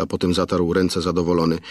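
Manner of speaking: plain reading voice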